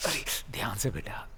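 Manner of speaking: soft breathy whisper to his hurt son; gentle whisper. Acted. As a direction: whisper it tenderly, barely voiced. Father tends injured son gently. (घायल बेटे से धीमी फुसफुसाहट)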